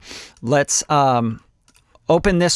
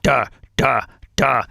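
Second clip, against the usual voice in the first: flat, dull mouth snare hits; mock a dead boring snare beat; boring vocal snare. Shown as a demonstration. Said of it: "the snare drum just gets really boring".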